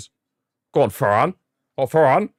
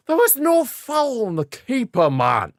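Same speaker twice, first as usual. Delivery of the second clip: much higher, slowed protest at the ref; indignant protest; 14 semitones higher, slower than usual. Real, not acted. Player complaining to the referee in high pitched disbelief. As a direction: plead your case in disbelief.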